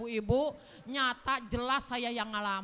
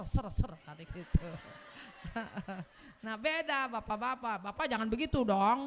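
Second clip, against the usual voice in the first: normal talking voice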